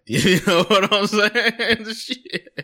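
high, loud, slow laughter through words; laughing hard. Real, not acted. Laughing at his own joke to his friend, high laughing words.

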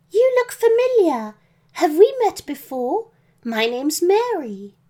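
put-on high-pitched young captive girl; young girl Mary. Captive girl greets Santa at the tower window, high girlish voice. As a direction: voice a little girl, lifted well above your own.

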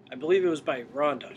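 ordinary delivery

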